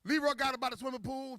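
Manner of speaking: usual speaking voice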